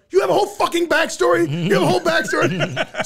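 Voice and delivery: high, loud, disbelieving rant with a laugh in it; incredulous rant. Retold or imitated. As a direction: yell it in disbelief, half laughing. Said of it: "in this rant".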